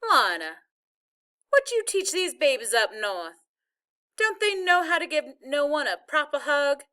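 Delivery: lightly voiced Southern granny, fussing; a touch of grandmotherly reproach; Southern grandma, mock scolding. A narrator's reading. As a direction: scold the family with a Southern lilt.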